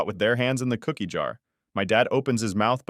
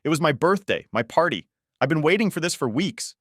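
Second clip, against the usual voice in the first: upset protest; 7 semitones higher than usual. Acted. Daughter protests to parents.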